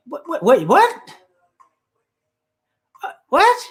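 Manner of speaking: sudden high, sharp what; startled what. Real, not acted. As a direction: jump up in pitch in disbelief. Reacting to Hispanics count.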